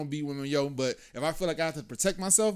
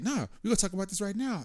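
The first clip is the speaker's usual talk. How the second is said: mimicking her, pitched well up; insistent girlfriend; 9 semitones higher than usual. Man imitating his girlfriend in an argument, insistent. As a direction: imitate a partner who will not drop it.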